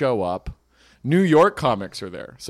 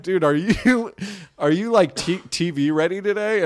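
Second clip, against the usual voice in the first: laughing tease; 6 semitones higher than usual. Retold or imitated. Teasing Steve Rogers, laughing.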